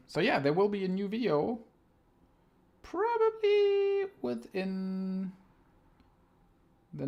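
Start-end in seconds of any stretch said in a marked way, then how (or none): 2.8-5.3 squeaky drawn-out. Pretend.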